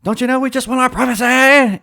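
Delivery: very high, loud hammed-up holler; Prince Harry yelling. Prince yelling back at the neighbor, shrieky mock yell. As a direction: shout it as a put-upon royal caricature. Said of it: "And Prince Harry yells".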